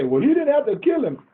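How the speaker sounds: high, scornful imitation of critics; mocking quote. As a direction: mimic the naysayers with a sneer. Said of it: "There's people say".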